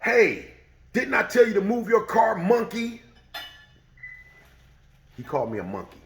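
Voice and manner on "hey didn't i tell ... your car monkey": high, loud sneering demand; angry insult. Retold or imitated. The old man insults him from the door. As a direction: spit the insult out. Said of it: "And he says".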